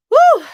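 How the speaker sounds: very high, loud exhale of relief; loud whooping whew; big relieved whew. Real, not acted. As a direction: blow out the tension. Relief after the story.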